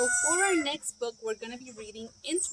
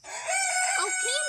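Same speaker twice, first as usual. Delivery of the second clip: Papa voice. Acted. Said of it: "said Papa".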